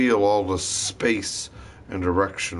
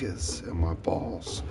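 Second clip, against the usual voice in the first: whisper; 4 semitones lower, 6 dB quieter, slower than usual. Real, not acted. Whispered mid-ramble.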